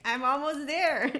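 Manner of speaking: high, loud, drawn-out imitated excitement; excited. Quoting a healing woman nearing her goal, excited. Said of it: "She's so excited".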